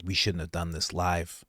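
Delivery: hushed imitation of panicked hosts; mock regretful mutter. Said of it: "They must be sitting there like".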